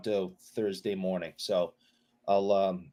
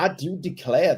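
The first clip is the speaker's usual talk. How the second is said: mock Southern belle accent, a bit higher and louder; 4 semitones higher, 5 dB louder than usual. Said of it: "that Southern accent might be worse".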